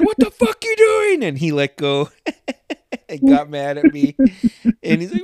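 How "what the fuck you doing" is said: much higher and louder, an outraged shout; angry yell. Retold or imitated. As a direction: shout at the kid who let go. Driver yelling at him, retold.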